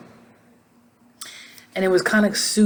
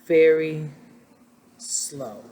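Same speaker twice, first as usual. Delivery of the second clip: drawn-out slow; 2 semitones lower, much slower than usual. Shown as a demonstration. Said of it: "the way he talks is very, very".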